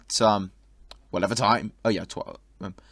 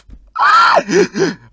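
high, loud burst of laughter; loud shrieking laugh; 8 dB louder than usual. Real, not acted. Loud laugh at a crude message.

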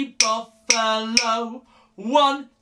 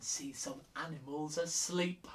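softer, whispered so the animals stay asleep; 7 semitones lower, 16 dB quieter than usual. Shown as a demonstration. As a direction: whisper it so nobody wakes.

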